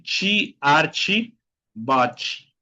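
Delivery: "Chi, archi, bochi" with ch endings; final TE as chi. Shown as a demonstration. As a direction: model "Chi, archi, bochi", stepping down in pitch. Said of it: "T más E al final de la palabra". ("Chi, archi, bochi" con final en chi)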